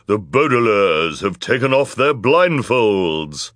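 loud, slow villainess proclaiming an accusation; villainous woman's cry. A narrator's reading. Villainous woman denouncing the children to the court, loud and drawn out. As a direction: cry it out as the wicked judge. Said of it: "cried the villainous woman in her low, deep voice".

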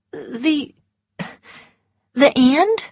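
high, faltering disbelief; stunned Scarlett. A narrator's reading. Scarlett asking Ashley in shock, high voice. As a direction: she can barely say it.

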